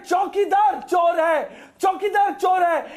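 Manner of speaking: high, slow slogan shout; shouted slogan quote. Retold or imitated. Panelist quoting a politician's slogan against him, shouted. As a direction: throw the slogan back, drawn out and high.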